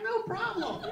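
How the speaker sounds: breezy clerk. Retold or imitated. Comic retelling of an American store clerk, high breezy mock voice. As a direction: toss it off like a clerk who doesn't care. Easygoing clerk quoted in retold exchange.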